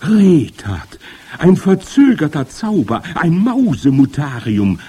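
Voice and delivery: high, loud jubilant cries; gleeful loud hooting; cheering witches. A narrator's reading. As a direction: whoop it out like an excited crowd of witches. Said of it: "johlten sie".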